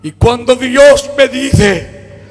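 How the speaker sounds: loud, high, impassioned sermon delivery; fervent Pentecostal preacher in full cry; full-force preaching. Acted. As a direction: preach it out, voice climbing. Preaching with rising fervour.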